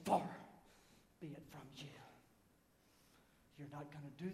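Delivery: barely voiced, very soft; hushed protest. Peter objecting to Jesus, hushed and barely voiced. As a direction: drop almost to a whisper.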